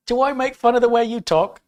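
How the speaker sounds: high, loud mimicked Aussie protest; sing-songy Australian. Australian racer protesting being mimicked, sing-songy Aussie. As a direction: imitate an Australian woman's sing-song, well up in pitch. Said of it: "it was very sing-songy".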